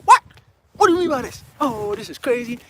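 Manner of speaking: high, loud, stunned mother's voice; shocked mom. Retold or imitated. Son acting out his mom's shocked reaction, high and loud. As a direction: squeal it in disbelief like mom.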